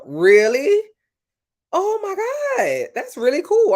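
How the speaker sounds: high, loud, drawn-out gasp of wonder; amazed. Real, not acted. Livestreamer thrilled by a viewer's Welsh fact, squealing high. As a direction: gush over a cool fact.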